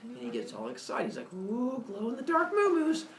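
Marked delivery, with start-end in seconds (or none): 1.3-3.1 put-on very high thrilled baby; baby Hermes. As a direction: coo it like a delighted toddler.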